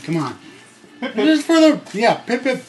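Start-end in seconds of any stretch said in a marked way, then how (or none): 1.1-2.6 mock British accent. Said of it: "Do your British accent".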